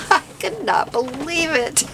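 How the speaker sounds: high, giddy excitement; bubbling over with glee; real giddiness over cheap craft supplies. Real, not acted. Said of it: "I know I sound giddy".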